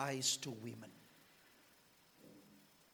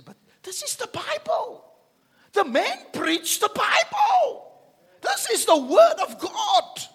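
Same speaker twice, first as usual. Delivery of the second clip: much higher, shouted mock protest; mocking objector; 14 dB louder than usual. Imitating a congregant objecting to the preacher, high mock shout. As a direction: ham up the listener's indignant protest. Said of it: "You say".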